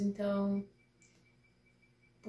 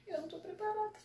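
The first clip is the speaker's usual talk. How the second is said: nervous squeak, much higher; 9 semitones higher, 3 dB quieter, faster than usual. Real, not acted. Fan before the premiere, squeaking she isn't ready. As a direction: squeal it out in panic.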